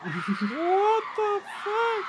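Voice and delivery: high, very slow, stunned; shocked. Real, not acted. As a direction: drag it out in disbelief. Reacting to video, shocked.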